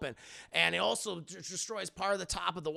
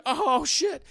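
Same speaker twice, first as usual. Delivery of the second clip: louder, higher sudden alarm; a quick startled swear; surprised curse; 8 semitones higher, 10 dB louder than usual. Retold or imitated. Ned sees phone in x-ray.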